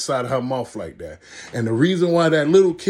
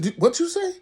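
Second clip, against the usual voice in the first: much higher, squeaky dad challenge; mock angry dad. Storyteller mimics the dad confronting his son, high mock challenge.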